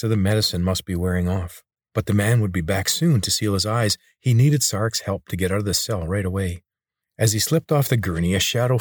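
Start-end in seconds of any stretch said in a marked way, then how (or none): none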